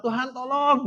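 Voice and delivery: high, loud acted cry to God; imitated desperate shout; desperate plea. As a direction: call for rescue with all urgency. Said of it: "Udah sampai nggak ada suaranya".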